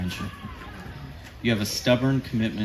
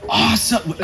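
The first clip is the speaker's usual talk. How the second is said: high, loud burst of delight; excited cheer; 12 semitones higher, 7 dB louder than usual. Retold or imitated. Couple cheers at the brother's dating news, loud. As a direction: cheer the good news into the phone. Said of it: "Carolyn and I are like".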